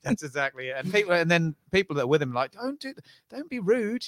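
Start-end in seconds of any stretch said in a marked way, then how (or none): 2.5-4.1 high, hurried mock reproach; embarrassed scolding. Customer's companion stopping the British impression, mock embarrassed scolding. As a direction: imitate a companion hushing a friend.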